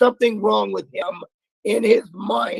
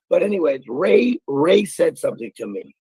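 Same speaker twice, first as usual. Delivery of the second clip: normal talking voice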